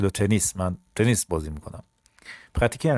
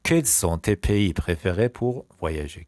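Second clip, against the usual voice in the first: slow repeat; 2 semitones lower, much slower than usual. Shown as a demonstration. Teacher repeats the question on favourite countries, slowly. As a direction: take it slowly, phrase by phrase.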